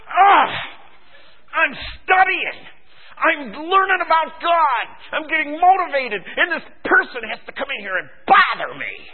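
high, hammed-up whine; exasperated complaint. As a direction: protest loudly like someone interrupted mid-study. Acted irritation at being interrupted.

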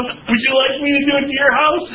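high-pitched, put-on helpful offer; eager neighbor. Neighbor offering to paint blood on your house, high and put-on. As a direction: pitch it up like an oddly cheerful neighbor.

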